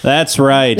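ordinary talk

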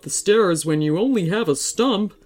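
lively storybook tree voice; chatty, genial magical tree; friendly talking tree. Acted. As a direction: explain it like a kindly, jolly tree.

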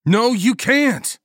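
high, loud, drawn-out shout; slow, forceful high-pitched protest; desperate yell. A narrator's reading. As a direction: yell to stop a friend's rash move. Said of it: "Ballmer yelled".

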